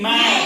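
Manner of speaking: teacher modelling मैट for the class. Shown as a demonstration. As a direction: say मैट firmly for the class to copy. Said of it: "M A P".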